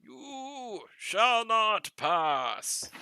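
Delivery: mock-epic wizard decree, drawn out; wizard quote. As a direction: hold each word like a grand wizard barring the way. Blocking friend in game, quoting wizard.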